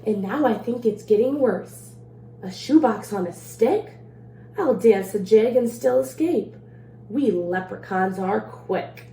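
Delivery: lively storybook read-aloud in the leprechaun's rhymes; reading to children. A narrator's reading. Librarian reading a leprechaun rhyme to children, playful read-aloud. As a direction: read it like a bedtime story for kids. Reads picture book to children.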